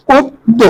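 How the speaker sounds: "পদ্ম" split pod, pause, do; pronunciation demo. Shown as a demonstration. Teacher explaining the o-sound, "পদ্ম" broken in two. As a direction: end "পদ্ম" on a separate o syllable. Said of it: "ওকার হচ্ছে বলছে".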